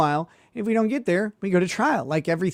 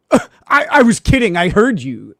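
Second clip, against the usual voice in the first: mocking the lawyer; 6 semitones higher, 9 dB louder than usual. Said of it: "he says".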